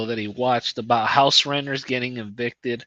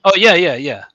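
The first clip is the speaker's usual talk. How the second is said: sudden recognition; 3 semitones higher, 7 dB louder than usual. Real, not acted. Suddenly recognizing the skinhead movie.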